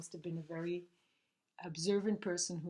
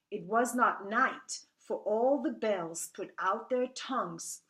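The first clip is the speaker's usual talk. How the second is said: measured, emphatic poem reading with falling line ends; poetry recitation; 2 semitones higher, 8 dB louder than usual. Acted. Reader reciting Emily Dickinson's poem about despair, poetry recitation. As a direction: recite it line by line, stressing key words.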